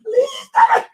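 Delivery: shrieking, tearful high laughter; laughing till crying. Real, not acted. Reacting to the woman's words, shrieking tearful laughter. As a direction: laugh until it turns into crying.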